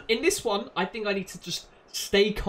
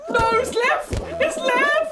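high, loud cry; high, loud urgent correction; urgent shout; 5 dB louder, slower than usual. Real, not acted. Urgent correction of the door choice.

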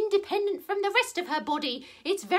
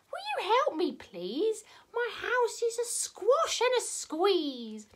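put-on higher-pitched, plaintive old lady voice; fretful old lady; 5 semitones higher, slower than usual. Old lady begs the wise old man's help, fretful. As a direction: plead like a flustered little old lady.

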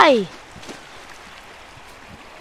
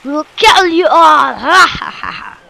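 mock-menacing threat ending in ha-ha laughter; playful villain shark; 4 dB louder than usual. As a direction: boast like a pretend villain and cackle. Gloats as killer shark, villain laugh.

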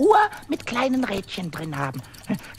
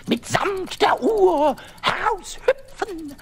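ordinary talk

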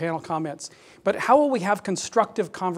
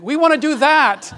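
high, loud, eager jump-in; imitating excited keenness, playing for a laugh; eager mock exclamation; 7 semitones higher, 10 dB louder than usual. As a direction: burst out as an overkeen fundraiser. Acted eager reply to donor.

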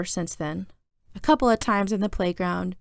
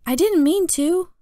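high, louder, slow protest; defensive kid; 9 semitones higher, 5 dB louder, slower than usual. A narrator's reading. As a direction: protest your innocence, pitch up high. Kid protests after being scolded.

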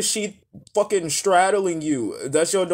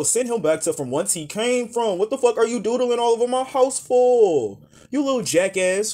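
high-pitched, exasperated telling-off; mock outraged scolding; 7 semitones higher than usual. Imagined parent to kid doodling on the house, mock outraged scolding.

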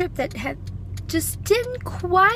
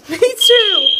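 raised, laughing over the alarm; over fire alarm; 5 semitones higher, 9 dB louder than usual. Real, not acted. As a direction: laugh and shout over noise. Hotel fire alarm blaring.

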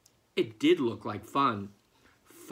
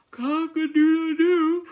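high, loud sleepy crow, much higher; yawning rooster crow; 13 semitones higher, 11 dB louder than usual. Acted. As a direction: crow like a rooster mid-yawn. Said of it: "yawned Marco".

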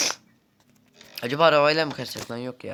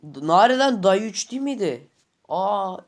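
raised, surprised voice; 5 semitones higher, 5 dB louder than usual. Real, not acted. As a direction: caught off guard, puzzled. Surprise at game day.

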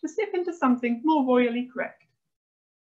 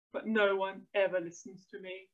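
soft, put-out mutter; muttering dad; 3 semitones lower, 6 dB quieter than usual. A narrator's reading. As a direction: grumble it under your voice. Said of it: "muttered Oliver's dad".

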